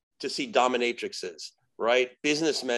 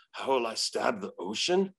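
lower, puzzled demon echoing a riddle; baffled demon; 4 semitones lower, 4 dB quieter than usual. Retold or imitated. Demon puzzles over the exorcist's riddle, low bafflement. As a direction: repeat the question lower, as if stumped. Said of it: "And she goes".

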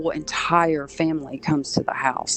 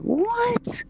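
high, sudden disbelieving what; a startled squeak of disbelief; shocked what. Retold or imitated. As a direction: jump up in pitch, can't believe it. Told she faces a demon.

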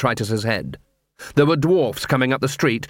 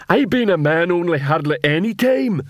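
higher-pitched troll voice; fed-up troll; 6 semitones higher than usual. Acted. Detritus scolds the other trolls, fed-up troll voice. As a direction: put on an exasperated troll, pitched up.

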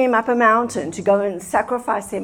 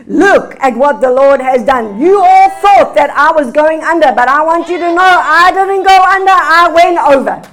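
triumphant testimony; 3 semitones higher, 5 dB louder than usual. Pretend. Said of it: "you will be able to say".

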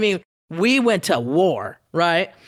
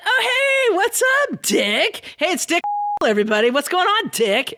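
over-the-top cheery radio taunt; mock taunting greeting; 10 semitones higher than usual. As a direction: greet him like an old friend to needle him. Taunting rival live on air.

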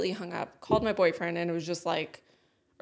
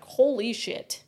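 louder, slow drawn-out shock; stunned realisation; 3 semitones higher, 5 dB louder, much slower than usual. Retold or imitated. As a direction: let the shock land slowly, word by word. Retold shock at diagnosis.

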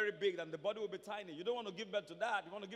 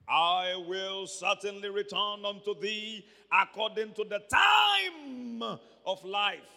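slow, booming proclamation; preacher thundering God's promise; the Lord speaking; 9 dB louder, slower than usual. Acted. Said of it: "now this is the Lord speaking".

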